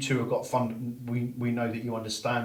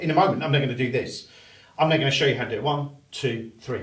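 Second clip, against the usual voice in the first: louder, driving count; tonality shift; 7 dB louder, faster than usual. Shown as a demonstration. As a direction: build to the third count. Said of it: "the way that the tonality changed".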